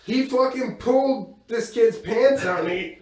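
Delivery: usual speaking voice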